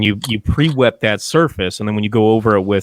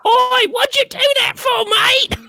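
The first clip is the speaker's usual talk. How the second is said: put-on much higher, squawky ork Cockney accent. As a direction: do the ork pain boy in Cockney. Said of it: "that fucking accent that they do".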